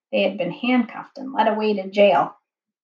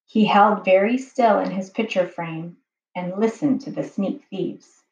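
ordinary delivery

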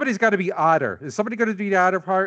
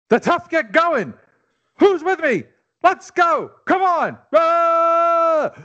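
fiery, rising rallying cry; roaring rallying speech; 7 semitones higher than usual. Acted. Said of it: "you're gonna read a famous speech".

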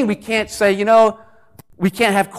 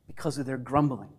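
usual speaking voice

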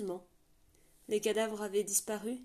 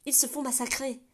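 raised, urgent outburst; alarmed protest; 5 semitones higher, 5 dB louder than usual. Acted. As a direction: cry out against the order. (Rana s'alarme auprès du commandant, voix pressante)

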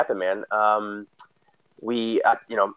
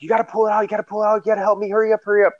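imitating her frantic pleas, much higher pitched; panicked pleading; 11 semitones higher, much faster than usual. Wife in labour begging her husband to pull the baby out, high frantic imitation. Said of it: "And so Mel's freaking out".

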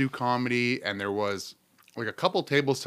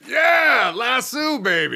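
loud, high whoop of delight; excited cheer; 9 semitones higher, 6 dB louder, much slower than usual. Real, not acted. Bandmate cheering the recalled saloon name, excited.

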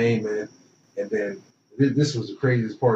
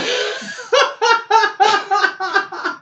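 big laugh; 5 dB louder than usual. Real, not acted. Reacts to recruiting memory.

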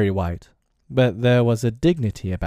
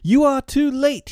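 high, loud boast; proud cry; 5 dB louder than usual. A narrator's reading. Boy announcing his deed to his friends, proud cry. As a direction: crow it out high, pleased with yourself. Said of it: "he cried proudly".

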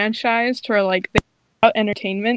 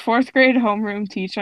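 ordinary delivery

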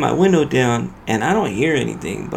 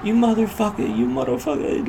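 retold yelling; 9 semitones higher than usual. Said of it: "I hear him".

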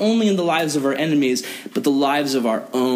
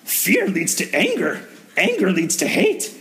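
slow alien Yoda, climbing much higher at the end; 6 semitones higher than usual. Retold or imitated. Youth pastor doing Yoda for the kids, slow and rising high. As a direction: wise little Yoda, rising into a squeak on hate. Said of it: "I'm gonna say this in the Yoda voice".

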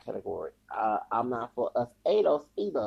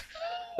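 breathy laugh; 9 dB quieter than usual. Real, not acted. Laughs at the steroids joke.

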